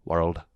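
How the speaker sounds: world said the Scottish way with a rolled r; Scottish-accented world. Shown as a demonstration. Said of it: "how to say the word girl".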